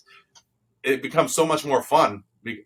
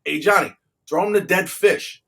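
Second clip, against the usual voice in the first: coach's call; 6 semitones higher than usual. Retold or imitated. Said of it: "and he goes".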